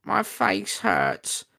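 high, slow whiny goblin. Acted. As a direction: whine it slowly like a hurt goblin. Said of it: "said Scab".

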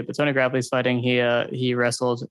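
normal talking voice

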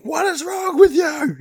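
high, loud mock scream at a baby; frazzled parent's over-the-top shriek; exasperated yell. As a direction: yell it in comic desperation. Exasperated yelling at toddler, acted.

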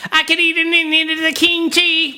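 high, every vowel an E; hammy Aussie, all vowels turned to E; bad Australian accent. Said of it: "Every vowel in the Australian accent is an E".

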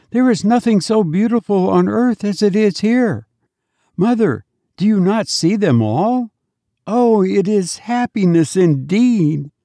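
put-on high, sweet little child calling his mother; a small dead child greeting his mother with joy; child's voice. A narrator's reading. Dead child to his weeping mother, high sweet child voice.

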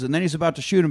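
ordinary delivery

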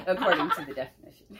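fast, laughing echo. Real, not acted. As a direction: repeat it while cracking up. Echoing partner through laughter.

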